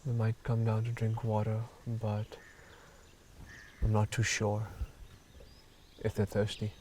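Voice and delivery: hushed soft voice; wildlife watching whisper. Real, not acted. Hushed watching at water hole.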